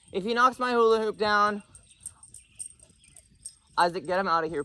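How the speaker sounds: loud, high call across yard; shouting. Real, not acted. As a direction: yell it from a distance. Yelling to brother outdoors.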